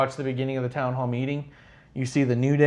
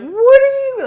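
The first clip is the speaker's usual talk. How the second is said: very high, loud outrage; shrieking hammed-up disbelief; Bailey reacting to people, shrill and loud; over-the-top shock; 12 dB louder, slower than usual. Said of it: "she's like".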